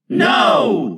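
loud, high group shout; all the children shout him down. A narrator's reading. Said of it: "they all replied".

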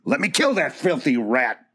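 Dobbs straining against Howard, crying out to kill; a mild hammed-up raging cry; furious cry. Dobbs held back by Howard, a mild furious cry. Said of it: "Dobbs cried".

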